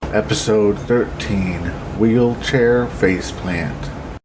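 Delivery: podcast episode title call; announcer. Acted. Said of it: "announces episode title".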